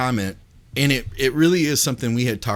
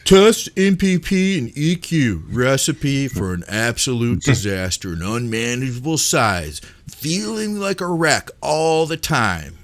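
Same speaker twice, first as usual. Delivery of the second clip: slow, drawn-out mock complainer, key words stretched; put-on reading voice; slower than usual. Co-host reading a critical viewer comment aloud, in a put-on voice. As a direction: read it as a hammed-up, drawling gripe. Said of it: "I'm gonna read it in a voice".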